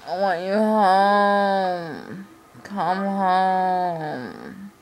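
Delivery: slow, high, drawn-out plea; pleading. Real, not acted. Pining for absent boyfriend.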